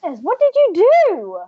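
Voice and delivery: high-pitched acted parental alarm; hammed-up shocked fussing; frantic parent. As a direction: exclaim like an overwrought parent. Frantic parent voiced on finding kids.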